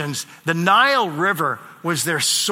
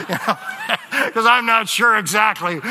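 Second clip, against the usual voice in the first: laughing; 6 semitones higher, 3 dB louder than usual. Real, not acted.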